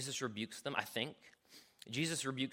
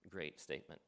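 ordinary talk, nothing marked

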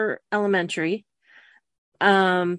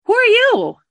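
high, loud frightened yell; 14 semitones higher, 8 dB louder than usual. Acted. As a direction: yell it at the witch. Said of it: "I yelled".

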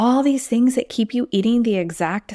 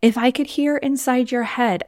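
ordinary talk, nothing marked

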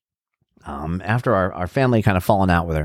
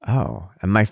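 ordinary delivery

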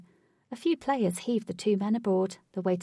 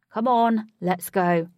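pushy; 9 dB louder, slower than usual. Acted. Huey pushing Katie.

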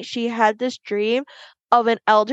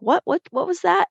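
high, baffled double-take; puzzled what; 9 semitones higher than usual. Retold or imitated. Retelling her baffled reaction to a friend, high and puzzled. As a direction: ask it high, thrown by the story.

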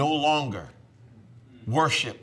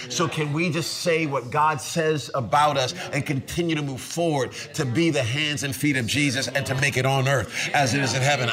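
normal talking voice

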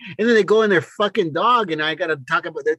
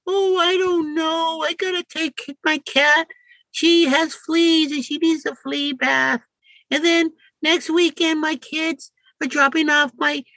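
mock excuse-making woman; 11 semitones higher than usual. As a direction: imitate her flimsy excuses, voice pitched way up. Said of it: "it's like pulling teeth".

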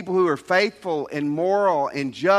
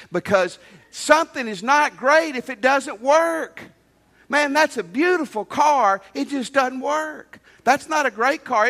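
fiery preaching; 6 semitones higher than usual. Acted. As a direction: bring it home to the congregation with fire. Preaching at full force.

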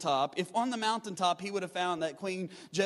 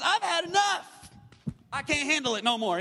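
loud, high cry of giving up; Elijah's despair; 6 semitones higher, 5 dB louder than usual. Retold or imitated. Pastor voicing prophet Elijah, loud and high. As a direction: voice Elijah at the end of his rope, much higher. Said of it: "He says".

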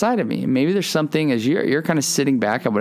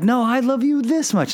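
much higher, insistent loving comeback; playful one-upping; 13 semitones higher than usual. Retold or imitated. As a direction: outdo a child's declaration of love, pitched right up. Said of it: "turned in kind of a competition".

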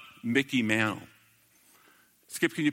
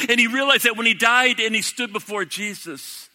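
louder, higher, driving sermon voice; preaching at full force; 6 semitones higher, 6 dB louder than usual. Acted. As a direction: preach it out, rising with conviction.

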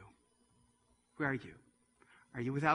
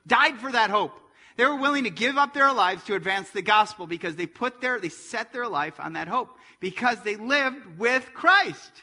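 loud, raised preacher's voice; full-force preaching; 7 semitones higher, 10 dB louder than usual. Real, not acted. Preacher telling congregation the church died for hope, impassioned preaching. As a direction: preach it hard, high and loud.

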